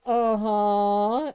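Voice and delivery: low, drawn-out, rising uh-huh; knowing skepticism. Retold or imitated. Mom hearing the rescue promise, skeptical. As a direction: stretch it low, unconvinced. Mom sees where story goes.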